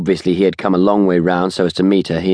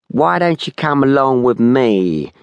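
slow, higher, phony American drawl; nasal American-accented whine; 6 semitones higher than usual. A narrator's reading. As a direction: whine it through the nose in fake American. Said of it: "He spoke with a nasal whine, aping an American accent".

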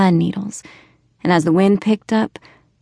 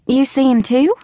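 put-on surprised child; 6 semitones higher than usual. A narrator's reading. Child surprised father sees ghost.

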